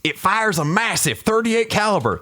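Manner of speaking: high shouted sergeant voice; drill sergeant bark. Acted. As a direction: bark it at the troops.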